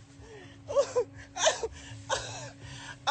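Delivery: high, wheezing, breathless laugh that won't stop; hysterical laughter. Real, not acted. Drunk streamer losing it at his friend's remark, wheezing laughter.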